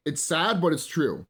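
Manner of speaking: ordinary talk, nothing marked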